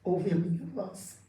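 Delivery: lightly voiced holy speaker; a hint of a solemn divine voice; voice of God. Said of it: "eu ouvi a voz dele falar".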